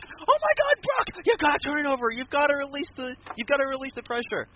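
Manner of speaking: imitating a manager pleading with his fighter; mock frantic manager. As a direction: beg your fighter in fake panic. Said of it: "Heyman's like".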